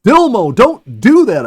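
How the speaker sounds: high, loud warning call. A narrator's reading. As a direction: call up to the squirrel in the tree. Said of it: "I called".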